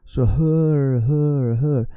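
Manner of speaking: her said slowly, dwelling on the h and the final r; drawn-out demo of the sound her; teacher demo. Shown as a demonstration. Teacher showing a student the sound her, careful demo. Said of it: "it's an H and finishes with an R".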